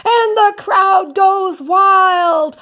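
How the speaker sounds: slow, high, loud stadium shout; crowd cheer. Acted. SpongeBob playing the crowd for himself, slow high shout. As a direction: stretch it out like a roaring arena.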